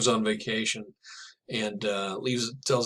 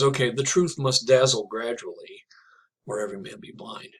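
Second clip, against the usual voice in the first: whispered. Acted. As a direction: breathe the lines as a whisper. Quotes the poem's closing lines.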